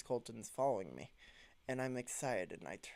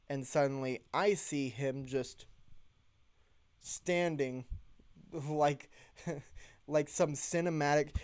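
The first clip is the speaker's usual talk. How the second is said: usual speaking voice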